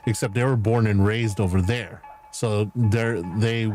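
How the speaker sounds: normal talking voice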